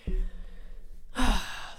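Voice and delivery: long unvoiced sigh, falling away; heavy sigh of indecision. Real, not acted. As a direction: let out a tired breath. Sigh while weighing second baby.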